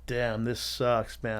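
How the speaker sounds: slow dejected sigh. Retold or imitated. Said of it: "So I'm like".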